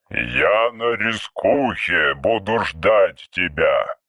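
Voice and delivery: low, very slow archaic voice; an old machine speaking in verse; ancient robot. Acted. (низкий медленный голос древнего робота)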